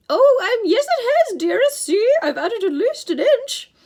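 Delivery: loud, high, fawning falsetto. A narrator's reading. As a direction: placate a monster in a false lady's voice. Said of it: "Grover said in his falsetto voice".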